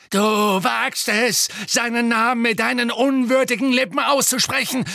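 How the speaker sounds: high, loud villainess screech; outraged shriek. A narrator's reading. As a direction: shriek in fanatical outrage, stretching the first words. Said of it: "kreischte Bellatrix".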